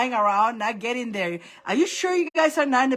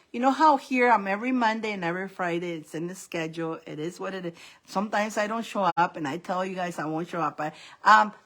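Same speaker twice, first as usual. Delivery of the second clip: normal talking voice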